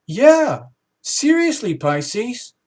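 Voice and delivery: loud, high, rising cheers; the crowd shouting yes at the stunned Pisces; excited cheering. Pretend. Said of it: "we're all going".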